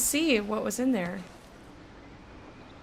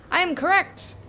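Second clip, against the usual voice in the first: triumphant; 10 semitones higher, 6 dB louder than usual. Real, not acted. Gamer checks his guess, triumphant.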